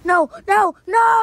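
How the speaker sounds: panicked high rising no's; terrified scream. Acted. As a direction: scream as the knife comes at you. Victim sees knife.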